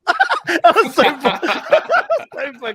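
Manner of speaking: laughing through the words; cracking up mid-sentence. Real, not acted. Laughing while telling drunk story.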